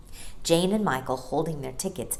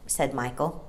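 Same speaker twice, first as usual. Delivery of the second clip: ordinary delivery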